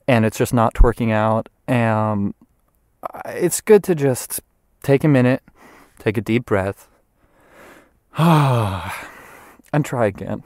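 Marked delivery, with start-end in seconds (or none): 3.3-10.4 slow soothing advice with a sigh; calm, slow advice. Acted. Calming advice to viewers.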